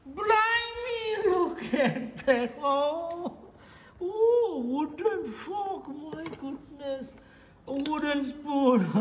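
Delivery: high, slow, drawn-out exclamations; exaggerated amazement. Showing viewers a drawer of wooden things, exaggerated amazement.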